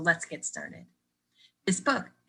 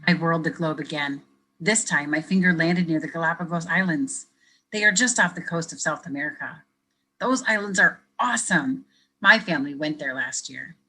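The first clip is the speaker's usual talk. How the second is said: lively picture-book reading; reading to children; 4 dB louder than usual. Acted. Teacher reading a picture book to kids, lively storytelling. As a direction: read it brightly for young listeners.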